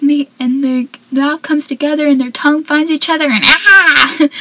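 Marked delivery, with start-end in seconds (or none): 3.3-4.2 loud exclamation; hammed-up shriek; mock swoon cry. Real, not acted. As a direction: cry out overwhelmed. Overcome by the kiss scene.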